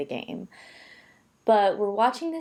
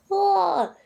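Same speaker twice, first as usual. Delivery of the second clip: high, loud relieved ahh; a loud exhale after a long moving day; relieved sigh; 12 semitones higher, 5 dB louder than usual. Retold or imitated. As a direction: let out a big mock sigh of relief.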